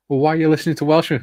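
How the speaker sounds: fast, loud and high, quoting a scoffer; quick mocking question. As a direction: rattle it off in disbelief. Friend mocking his music choice.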